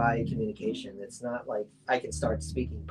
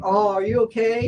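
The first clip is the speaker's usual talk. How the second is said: much higher, louder worried fussing; imagined concern; 10 semitones higher, 10 dB louder than usual. Retold or imitated. What they hoped to hear on waking, imitated concern. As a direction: gush with concern, acted out.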